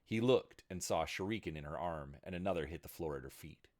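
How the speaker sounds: usual speaking voice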